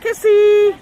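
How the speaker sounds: high, loud shout for the dog; calling out. Real, not acted. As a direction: shout her name across the yard.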